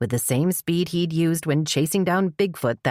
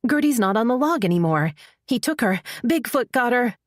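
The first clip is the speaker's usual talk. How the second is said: panicked; 8 semitones higher than usual. Acted. Mother panics over missing daughter.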